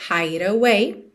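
"hide away" joined with a tapped D, "hai-dauei"; linked words. Shown as a demonstration. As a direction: flow "hide" into "away" with no break. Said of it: "juntando hide e away". ("hide away" ligado, D batido)

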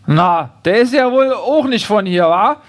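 put-on Berlin-dialect busybody; prejudiced neighbour grumbling in dialect; suspicious neighbour. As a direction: sneer about the newcomer, Berlin style. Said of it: "fragt sie".

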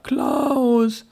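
high cry of the name, a touch strained; calling out across the dark; anxious call. Acted. Father searching at night for his son, a high anxious call.

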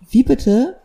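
high, loud wie bitte; incredulous. Retold or imitated. Therapist hears the crying baby, incredulous. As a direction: react in mock disbelief.

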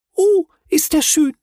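very high, loud delighted squeak; shy tiny creature exclaiming; little creature's cry. A narrator's reading. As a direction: burst out in awe. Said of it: "rief das kleine Knütt aus".

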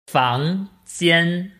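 usual speaking voice

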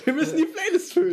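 high playful insistence; excited. Real, not acted. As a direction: push it, giddy and high.

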